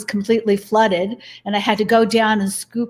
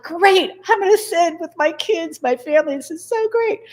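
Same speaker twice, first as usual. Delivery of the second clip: excited; 9 semitones higher than usual. Retold or imitated. Retold glee at ascending.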